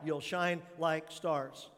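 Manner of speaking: ordinary talk, nothing marked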